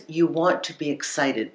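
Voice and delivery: ordinary delivery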